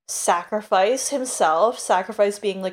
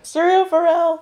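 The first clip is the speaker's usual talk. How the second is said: emotional cry; 9 semitones higher, 6 dB louder than usual. Real, not acted. Echoing emotional name.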